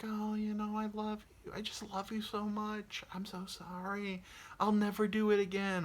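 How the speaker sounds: cat sweet-talking her owner after a bite. Pretend. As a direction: apologise like a manipulative partner.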